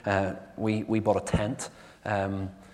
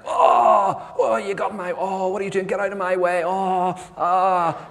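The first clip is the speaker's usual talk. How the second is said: much higher, louder exasperated yell; road rage shout; 8 semitones higher, 5 dB louder than usual. Retold or imitated. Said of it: "And you're like".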